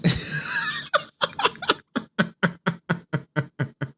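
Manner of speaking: a long high shrieking laugh; screaming laugh. Real, not acted. Cracking up at a ban to twenty twenty-eight, shrieking laugh. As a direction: howl with laughter.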